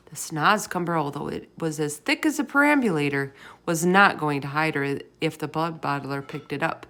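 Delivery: ordinary delivery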